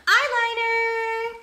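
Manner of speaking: very high, slow drawn-out call; singsong announcement. Real, not acted. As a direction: sing it out excitedly. Announcing next step.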